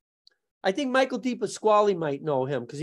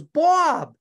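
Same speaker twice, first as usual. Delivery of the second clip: loud, higher call across the room; 7 semitones higher, 6 dB louder than usual. Retold or imitated. Paul Gleason hailing Bob across the gym, loud call. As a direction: call his name to grab his attention.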